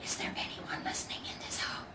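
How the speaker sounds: soft breathy whisper into the dark; hushed whisper. Acted. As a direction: whisper so nobody wakes. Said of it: "he whispered".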